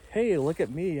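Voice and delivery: ordinary talk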